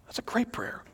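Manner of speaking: near-whisper, soft and unvoiced; hushed appreciation. Real, not acted. Pastor reflects on the prayer just read, hushed. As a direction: breathe it out quietly after the prayer.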